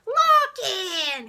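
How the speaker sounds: loud, high cartoon holler; human-cartoon shout of greeting; the Wolf. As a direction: yell the name like a clown. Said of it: "he's like a clown, like a cartoon".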